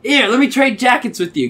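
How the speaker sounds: high, loud playful offer; mock eager. Stranger offering to trade his blazer, playfully eager. Stranger proposes jacket swap.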